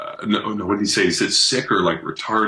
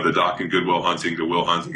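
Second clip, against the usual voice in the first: normal talking voice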